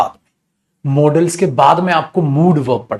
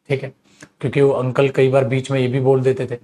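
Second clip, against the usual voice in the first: ordinary delivery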